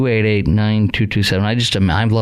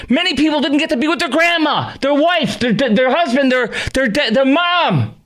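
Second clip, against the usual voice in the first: much higher, impassioned furious rant; angry outburst. Real, not acted. Radio host raging at Fauci to listeners, angry high-pitched rant. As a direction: voice climbing high with real anger and grief. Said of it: "I'm sorry I got angry there".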